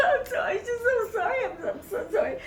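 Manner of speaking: high mock-sobbing apology; squealing imitation of helpless weeping; hysterical crying. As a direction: blubber thanks and apologies, hammed up. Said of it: "I'm crying".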